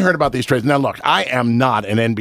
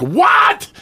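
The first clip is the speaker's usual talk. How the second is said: a sudden high, loud shout of disbelief; stunned shout at the radio; shouted what; 14 semitones higher, 5 dB louder than usual. Retold or imitated. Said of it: "I screamed".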